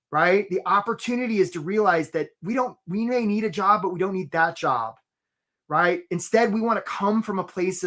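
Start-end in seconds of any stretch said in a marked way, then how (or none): none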